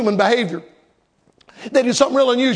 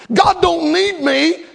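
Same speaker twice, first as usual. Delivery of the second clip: ordinary delivery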